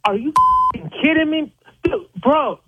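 high, incredulous outburst; caller's victim exploding at a cookie blackmail, high and incredulous; outraged disbelief. Real, not acted. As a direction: blurt it out, furious and stunned. Victim hears blackmail demand.

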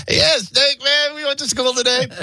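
put-on high-pitched kid voice; mock squeaky schoolkids' reply; imitating schoolboys. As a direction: answer like eager little boys, very high. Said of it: "And we go".

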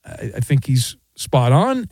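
ordinary talk, nothing marked

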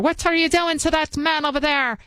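put-on Irish accent. Host imitating a Belfast woman, Irish accent. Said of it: "I'm trying to do my Irish".

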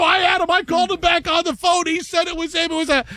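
high, shouted blame; hammed-up panicked shouting; mock yelling. Producers blaming each other for the prank, mock shouting high.

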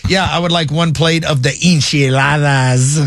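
"enchiladas" given a showy Spanish pronunciation, lightly mocking; a friend showing off his Spanish; mock Spanish accent. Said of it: "then they wanna be like".

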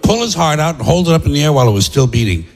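ordinary talk

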